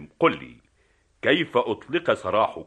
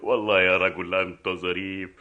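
amused laughter. Acted. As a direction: burst out laughing, then speak. Guffaws at the other's joke. (يقهقه معجبًا بظرافته)

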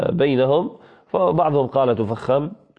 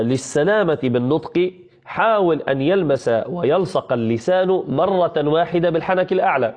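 ordinary delivery

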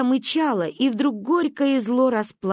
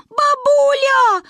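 very high, loud, drawn-out call; child's bawling yell; 8 dB louder, much slower than usual. A narrator's reading. Girl yells for granny over fence, shrieking bawl. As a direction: scream for granny with all your might. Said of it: "орала я".

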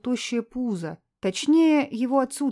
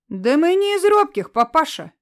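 defiant shout; 7 semitones higher, 8 dB louder than usual. Acted. Boy defying man.

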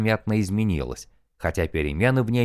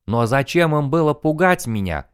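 exclaiming; 6 semitones higher than usual. A narrator's reading. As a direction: cry it out in disbelief. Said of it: "воскликнул он".

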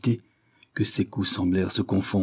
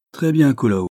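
plain reading voice

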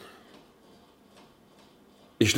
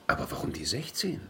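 unvoiced, much softer whisper; hushed conspiratorial whisper; whispered question; 11 dB quieter, faster than usual. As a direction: breathe the question as a secret. Musing over bet numbers.

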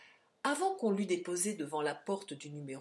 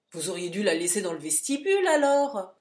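louder, gruff complaint; grumbling porter; 9 dB louder than usual. Acted. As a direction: grumble like a put-upon porter. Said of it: "grommela le porteur".

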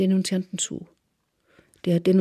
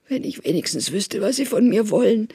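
pleading, plaintive voice climbing higher; thin childlike whine; 3 semitones higher than usual. A narrator's reading. Denunciant to his captors, pleading in a childlike whine. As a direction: beg in a thin, childish lament. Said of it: "kindlicher Klagelaut aus seiner Kehle".

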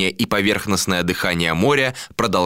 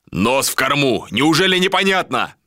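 raised, higher command; exasperated order; 6 semitones higher than usual. A narrator's reading. Captain swears and repeats orders, exasperated raised call. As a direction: call it out, impatient with the crew. Captain shouts angrily at the ships.

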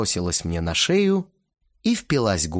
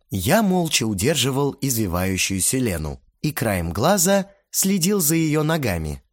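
ordinary talk, nothing marked

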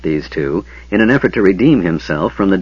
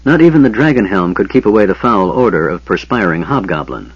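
ordinary delivery, nothing marked

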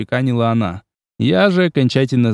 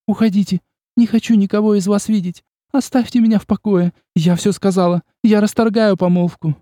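higher, near tears, insistent; tearful outburst; 9 semitones higher than usual. A narrator's reading. Olga tearfully ending the engagement, agitated. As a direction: push them away, close to crying.

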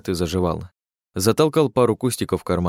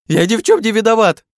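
panicked shout; 13 semitones higher, 7 dB louder than usual. A narrator's reading.

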